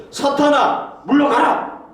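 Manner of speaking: "사탄아, 물러가라" shouted high and forceful, "물러가라" stretched; strong rebuke. Acted. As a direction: hurl the words out, furious. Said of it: "엄청나게 강하게 얘기를 한 거예요". ("사탄아, 물러가라"를 높이 외치며 강하게 꾸짖듯이)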